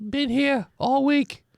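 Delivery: homeless man voice. Acted. Homeless NPC answers.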